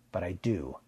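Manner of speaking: ordinary delivery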